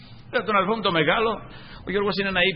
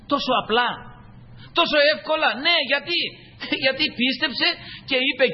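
preaching; 10 semitones higher than usual. Real, not acted. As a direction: drive the point home to the flock. Preacher stresses simplicity. (κήρυγμα με έμφαση)

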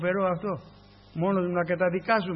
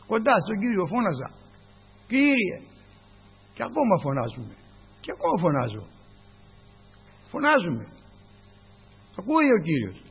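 louder rising exhortation; fervent preaching; 3 semitones higher, 3 dB louder than usual. Acted. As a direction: urge them to cry out. Preaching about crying out.

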